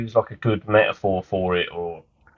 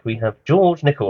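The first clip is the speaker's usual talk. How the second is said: ordinary talk, nothing marked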